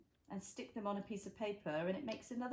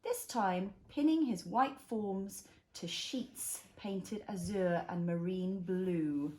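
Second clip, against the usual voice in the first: slow, gentle picture book reading; reading to a toddler; 4 semitones lower, 5 dB louder, slower than usual. A narrator's reading. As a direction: read it slowly for a little one. Reads picture book to child.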